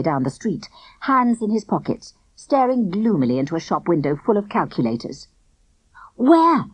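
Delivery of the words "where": high, louder surprised question; sudden surprised where. A narrator's reading. Barney asks loudly.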